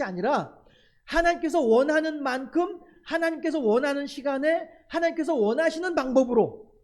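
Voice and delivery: fervent preaching build. Acted. Pastor urges the congregation, preaching with rising emphasis. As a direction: drive each phrase home like a preacher.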